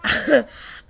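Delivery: breathy laugh; a quick airy laugh. Real, not acted. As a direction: chuckle. Laughs at own joke.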